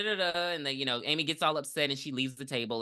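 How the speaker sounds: ordinary talk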